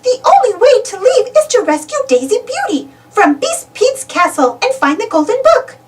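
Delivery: a hint of a know-it-all knight; tiny knight. Knight explaining the way out to Goofy, lightly voiced. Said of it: "says the first knight".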